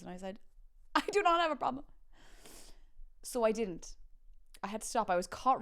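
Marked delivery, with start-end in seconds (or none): 0.9-1.8 mock indignant denial. Retold denial to mom.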